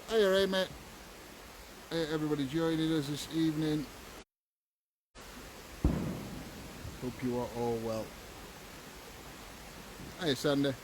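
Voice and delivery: normal talking voice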